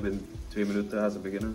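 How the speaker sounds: ordinary delivery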